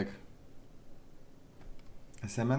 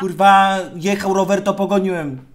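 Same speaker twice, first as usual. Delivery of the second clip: angry outburst; 8 semitones higher, 10 dB louder than usual. Real, not acted. Annoyed answer in heated quarrel.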